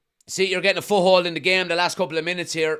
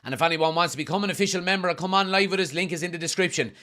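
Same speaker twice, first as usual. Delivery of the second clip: usual speaking voice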